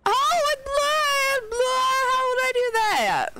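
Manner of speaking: high, drawn-out alarmed cry; squeaky startled oh; startled dismay. Real, not acted. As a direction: caught off guard by the screen. Screen suddenly blurs.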